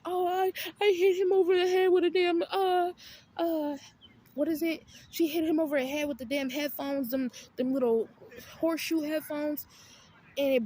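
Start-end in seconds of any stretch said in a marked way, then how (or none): 0.0-4.8 higher, louder whiny put-on; mock whiny friend. Imitating the roommate confessing the headphone fight, whiny. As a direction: whine like the dramatic friend. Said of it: "telling me like".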